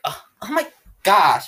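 put-on high, loud Valley girl dismay; Valley girl accent. Girl imitating Brittany types for friend, Valley girl accent. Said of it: "that's the Valley girl accent".

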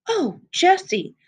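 high, slow, overjoyed cry; joyful surprise. A narrator's reading. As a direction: she lights up at the sight of him. Girl overjoyed to see friend.

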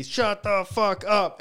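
loud, high, drawn-out heckle; slow, stretched mock jeer; imitated crowd yell. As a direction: mimic a crowd shouting someone down. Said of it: "everyone would be".

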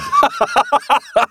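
squealing high laugh. Real, not acted. Laughing at the dub.

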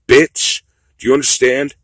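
high, loud demand; furious shouted challenge; angry shout. Real, not acted. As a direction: yell it in their face. Rant peaks at listener.

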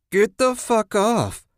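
high, drawn-out mocking voice; mocked. A narrator's reading. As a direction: mimic his shout slowly in a high, taunting pitch. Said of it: "a voice mocked".

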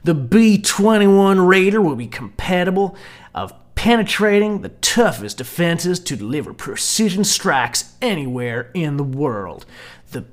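booming American military ad voice; American military accent. Shown as a demonstration. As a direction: read it like a military recruitment ad. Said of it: "my American military accent".

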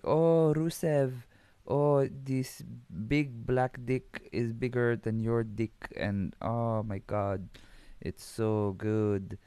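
mock Russian accent, slow and drawn out; hammed-up Russian accent, lingering on each phrase; Lana. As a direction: imitate a swooning woman with a Russian accent. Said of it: "give her the Russian accent".

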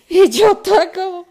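high, loud laugh breaking into the words. Real, not acted. Laughing as she recalls the insult to her friend. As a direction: burst out laughing remembering.

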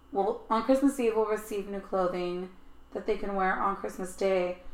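ordinary delivery

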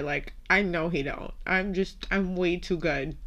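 usual speaking voice

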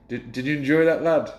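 put-on a light Scottish accent, half-hearted; mild imitation of a Scottish manager's lilt. Manager at the urinal, in a light Scottish accent. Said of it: "and he went in his Scottish accent".

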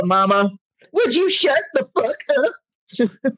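high, slow mock snap; mock snapping at mom. Teen mouthing off to mama, high and slow. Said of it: "you tell her".

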